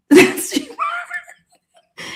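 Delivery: high laughing echo, drawn out very slowly; mock the angry line through a laugh; laughing repeat. Echoing the angry roommate's words, laughing high and slow.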